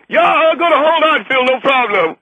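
hammed-up phony Chinese delegate; fake Chinese accent. Chinese delegate agreeing to hold on, fake Chinese accent. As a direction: put on a bad Chinese guest voice. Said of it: "and that Chinaman voice".

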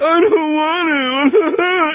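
high, slow, whiny sob; mock crying kid. Kids refusing to snorkel, a hammed-up high whiny wail. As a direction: wail it like a sulking child. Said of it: "And they're crying".

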